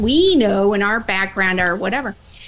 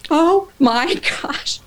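much higher, slow, a laugh breaking in; amazed laughing gasp; 7 semitones higher, 3 dB louder, much slower than usual. Retold or imitated. Boss seeing her certifications, slow amazed laugh. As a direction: let astonishment stretch it out.

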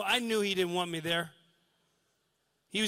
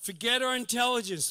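usual speaking voice

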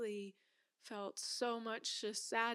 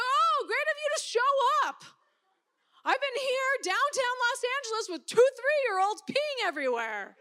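loud, high, biting sarcasm; sarcastic anger; 12 semitones higher, 11 dB louder than usual. Retold or imitated. Scolding the wife who showed up late, loud sarcastic anger. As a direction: snap the praise so it stings.

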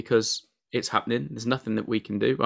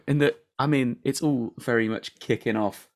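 normal talking voice

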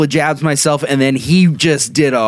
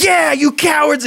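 much higher mocking taunt; brother taunting. Brother jeering as kids drive off, high mocking taunt. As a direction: jeer at the fleeing kids. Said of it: "my brother's just like".

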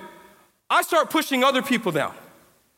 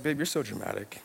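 unimpressed wife; 10 dB quieter than usual. Retold or imitated. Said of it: "My wife's like".

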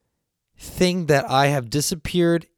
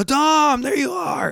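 much higher, loud relieved call; 3 dB louder, slower than usual. Retold or imitated. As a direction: shout his name across the front. Friends finally find him.

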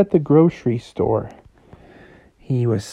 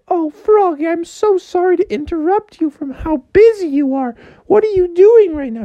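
mom voice. Retold or imitated. Mom calling Froggy to fetch ingredients, high and sweet. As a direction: sing out to your son sweetly. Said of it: "She's like".

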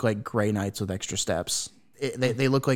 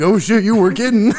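mock loud surprise; 10 semitones higher, 12 dB louder than usual.

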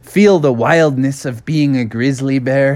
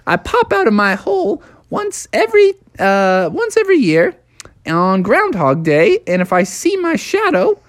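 high-pitched, chatty cartoon groundhog; Punxsutawney Phil; 13 semitones higher than usual. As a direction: show off your town as a tiny celebrity. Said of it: "Phil was like".

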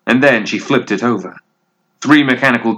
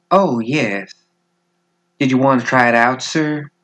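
quick, teasing and a bit higher; flirty; 4 semitones higher than usual. Acted. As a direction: tease him sweetly, rushing the question. Colette flirts with a dangerous man.

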